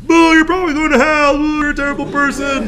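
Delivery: high mock jeering voice. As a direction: jeer at the child for the crowd.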